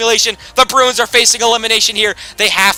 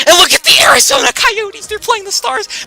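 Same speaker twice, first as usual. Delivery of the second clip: amazed; 5 dB louder than usual. Real, not acted. Surprise at Coyotes advancing.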